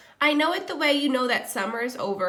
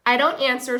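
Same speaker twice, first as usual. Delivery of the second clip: plain reading voice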